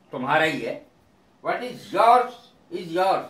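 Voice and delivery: usual speaking voice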